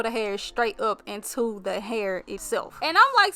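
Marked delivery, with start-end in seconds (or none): 2.8-3.3 excited. Real, not acted. Laughing at own stumbling.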